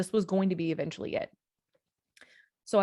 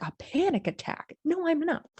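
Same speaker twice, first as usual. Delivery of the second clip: higher-pitched incredulous denial; disbelieving protest; 10 semitones higher than usual. Retold or imitated. Patient refusing nurse's panic attack diagnosis, disbelieving protest. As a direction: push back in disbelief, voice jumping up.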